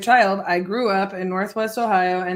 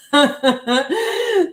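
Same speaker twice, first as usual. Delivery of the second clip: loud laugh, pitched up; 5 semitones higher, 7 dB louder than usual. Real, not acted. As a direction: laugh out loud at your own joke. Laughs at own onion line.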